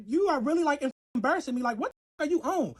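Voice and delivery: retold upset plea. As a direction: lash out while embarrassed. Embarrassed, shoved into street.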